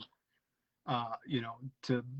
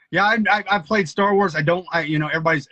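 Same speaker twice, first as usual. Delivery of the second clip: excited; 4 semitones higher, 12 dB louder than usual. Real, not acted. Eager to share Star Wars play.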